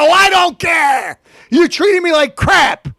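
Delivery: slow, high furious shout; angry shout. Real, not acted. Ranting caller shouts at the rude manager, furious.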